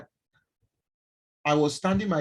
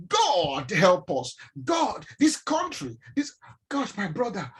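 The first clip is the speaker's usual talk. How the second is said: drawn-out, high mock exasperation; slow, rising imitated griping; complaining driver; 5 semitones higher, slower than usual. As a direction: wail about the country like a fed-up motorist. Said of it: "he will complain about everybody".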